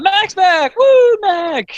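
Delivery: loud, high-pitched hammy cheering; over-the-top fan celebration; excited cheer. Pretend. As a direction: whoop it up for a returning favorite. Celebrating a character's return.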